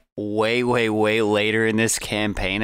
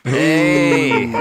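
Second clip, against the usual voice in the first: surprised shout; 6 semitones higher, 8 dB louder than usual. Real, not acted. Reacting to a crude joke.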